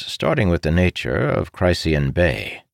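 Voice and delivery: ordinary delivery, nothing marked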